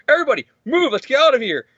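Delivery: mock urgent order. Hero orders everyone out.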